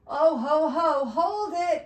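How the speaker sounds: loud, high halt from the elf; booming storybook "hold it"; elf's cry. Acted. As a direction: cry out to stop an intruder. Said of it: "cried the elf".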